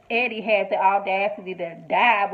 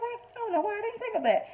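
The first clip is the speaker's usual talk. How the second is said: mock sheepish; 13 semitones higher, 4 dB quieter than usual. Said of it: "They're like".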